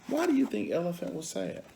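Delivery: ordinary delivery, nothing marked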